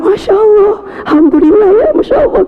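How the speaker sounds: put-on happy exclamation hiding worry; mock delight. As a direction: fake the joy, pitch it way up. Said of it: "Saya bilang".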